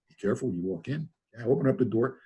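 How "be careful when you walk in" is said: quick, much softer mocking repeat; wry inner echo. The speaker silently mocking Richard's warning, quick soft mutter. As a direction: mutter the warning back under your breath, quickly. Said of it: "I thought".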